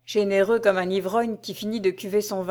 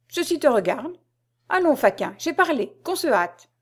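higher-pitched impatient lordly order; acted imperious impatience, pitched up; imperious command; 6 semitones higher than usual. A narrator's reading. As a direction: dismiss the servant and order haste. Regent cuts off servant's objection.

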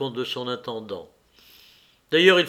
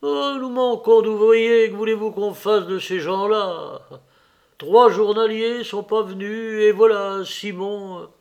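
high, loud steward's lament; put-upon steward voice; complaining steward; 6 semitones higher, 5 dB louder than usual. Acted. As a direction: plead helplessness with raised voice and pitch. Voices the intendant in dialogue.

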